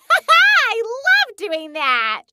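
high, slow gleeful gushing; laugh, then draw it out with relish; laughing delight. Acted. Delight after zapping.

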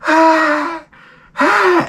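high held fake "ah"; forced delight. Hungover guest pretending to enjoy the song, strained delight. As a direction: squeal a fake pleased noise.

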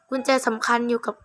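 normal talking voice

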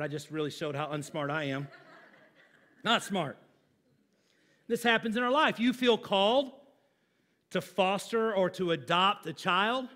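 ordinary delivery

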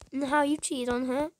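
normal talking voice

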